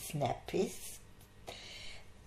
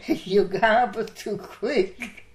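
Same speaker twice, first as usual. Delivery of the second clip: laughing through the words, louder and slower; laughing quote; 3 semitones higher, 9 dB louder, slower than usual. Retold or imitated. Nurse laughing at Scottish colleague's fast speech, laughing. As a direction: giggle out the complaint about fast talk. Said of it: "like I was to you and saying".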